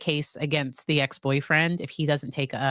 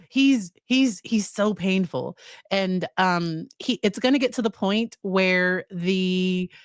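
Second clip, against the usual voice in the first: ordinary talk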